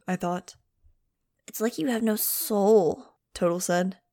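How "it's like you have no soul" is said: slow, loud dog-character whine; melodramatic complaint. A narrator's reading. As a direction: draw it out, pitch swooping into a wounded protest. Dog Total's dramatic complaint.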